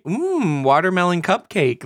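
high, dreamy "watermelon cupcake" in a mock swoon; imitating wife. Husband mimics wife cooing at the wine shelf, high and dreamy. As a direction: coo it like she's charmed by the bottle. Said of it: "she tells me".

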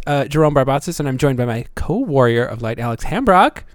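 normal talking voice

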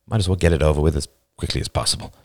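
low, quick shrug of a thought; offhand thought. A narrator's reading. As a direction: toss it off fast and low. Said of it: "he also thought".

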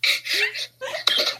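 squeaky burst of laughter; high laugh. Real, not acted. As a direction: laugh high at the silly word. Laughing at the funny word.

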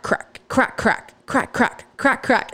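cracking ice sounds. Retold or imitated. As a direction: tap it out like an ice pick chipping.

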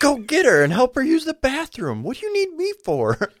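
high, loud disbelieving scold; exasperated dad. Retold or imitated. Father scolding child at night.

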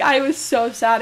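usual speaking voice

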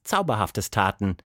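plain reading voice

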